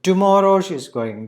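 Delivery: TOMORROW high and loud; stress on TOMORROW. Shown as a demonstration. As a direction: announce TOMORROW as the important news. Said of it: "That is very important".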